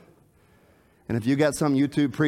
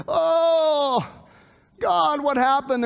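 high, drawn-out cry of dismay; acted lament, slow and pitched up; dismayed wail; 9 semitones higher, much slower than usual. Preacher voices believers whose faith fails, dismayed wail.

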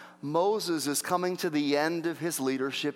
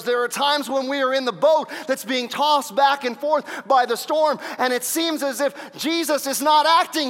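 high, loud preacher's delivery, building; full-force preaching; 7 semitones higher, 6 dB louder than usual. Acted. Preacher telling of the storm-tossed boat, full-force preaching. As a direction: drive it up toward a climax.

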